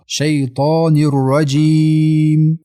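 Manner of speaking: slow 'شیطان الرجیم' with very long held ee; elongated ending. Shown as a demonstration. Teacher shows the madd before meem, long and chanted. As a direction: hold the last vowel of 'الرجیم'.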